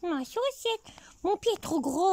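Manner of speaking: high squeaky puppet voice for the teddy; teddy bear voice. Pretend. Grandmother voicing the teddy bear for her granddaughter, high and squeaky. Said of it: "et je parle comme ça avec lui".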